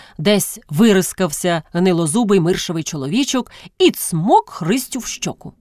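usual speaking voice

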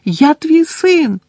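very high, loud, slow scream; desperate scream. A narrator's reading. Son screaming at Crouch, desperate. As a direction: scream it, breaking. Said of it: "кричав він".

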